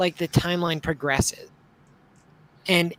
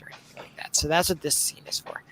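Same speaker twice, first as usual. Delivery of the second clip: whispering; 3 semitones lower, 7 dB quieter than usual. Real, not acted. Aside after explaining the scene.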